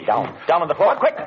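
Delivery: urgent shout. Acted. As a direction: yell at him to hit the floor now. Sudden danger, urgent warning shout.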